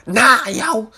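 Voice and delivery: high, loud put-on [name] voice; [name] impression. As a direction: do a quick [name] bit. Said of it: "I could do a [name] impersonation".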